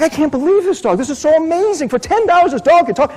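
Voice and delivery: high, excited disbelief; can't get over this dog, voice climbing; amazed. Acted. Businessman marvels at the ten-dollar talking dog, high excited disbelief.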